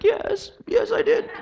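high tearful mother's voice, laughing through tears; mother crying. Retold or imitated. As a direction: imitate mom's joyful sobbing. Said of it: "And she starts crying".

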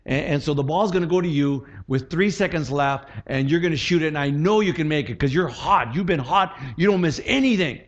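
pep talk building in force and pitch; encouraging coach. Acted. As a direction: fire up the player with rising confidence. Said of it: "A coach would, would say".

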